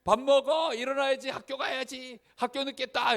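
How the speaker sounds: high, loud parental scolding; acted rapid-fire wake-up nagging; nagging shout. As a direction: nag a sleeping child out of bed, yelling. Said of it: "잔소리 속에 깨요".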